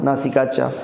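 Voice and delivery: ordinary delivery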